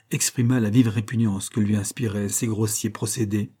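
ordinary delivery, nothing marked

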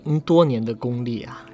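ordinary delivery

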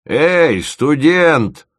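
громко и высоко, окриком; крикни вслед уходящему; рявкнул вдогонку. A narrator's reading. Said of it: "рявкнул ему вдогонку Мамонт". (дед громко окликает уходящего студента)

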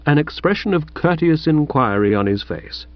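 plain reading voice

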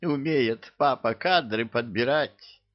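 ordinary delivery, nothing marked

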